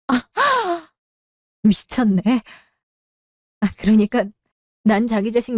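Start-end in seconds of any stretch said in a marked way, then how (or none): 0.2-0.8 sharp high gasp of shock. Acted. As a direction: gasp as if the truth just hit you. Wife reads husband's affair texts.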